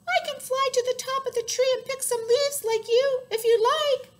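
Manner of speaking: high, loud little bird voice; chirping bird. A narrator's reading. Bird offering the elephant help, chirping high and loud. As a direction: chirp it out on one high pitch. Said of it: "the bird chirped".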